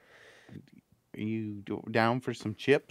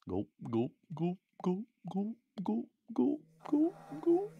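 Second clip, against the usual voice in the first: mock gulping; 10 semitones higher, 4 dB quieter than usual. Mocking the gulping sound on mute.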